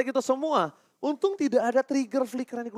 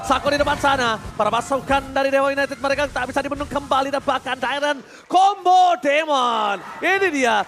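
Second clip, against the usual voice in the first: dramatic announcer call; 4 semitones higher than usual. Real, not acted. Live play-by-play, dramatic call.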